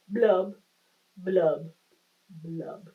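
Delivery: fading glum fish bubbles; gloomy fish blubs. Acted. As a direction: let each blub sink away. Fish's refrain ending.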